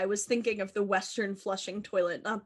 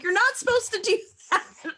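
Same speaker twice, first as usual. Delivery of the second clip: laughing quote; 11 semitones higher than usual. Retold or imitated. Mock scolding quote at the Met.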